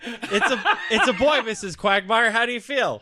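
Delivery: high, cheery delivery-room announcer; Family Guy doctor. Retold or imitated. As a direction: announce the birth brightly. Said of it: "It's a Family Guy bit".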